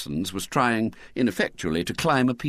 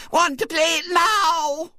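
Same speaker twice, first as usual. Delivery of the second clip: put-on high, slow, shrill child's demand; shrill little girl; 4 dB louder, slower than usual. A narrator's reading. Violet Elizabeth demanding a party game from the grown-ups. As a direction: insist like a spoilt little girl. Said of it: "demanded Violet Elizabeth shrilly".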